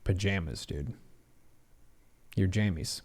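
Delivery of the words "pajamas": "Pajamas" with "jam" in the middle; own pronunciation. Shown as a demonstration. Quiz taker confirming their own version, "Pajamas" as puh-JAM-uz. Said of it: "I say pajamas".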